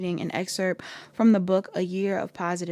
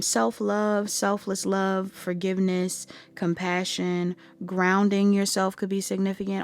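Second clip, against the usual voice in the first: ordinary talk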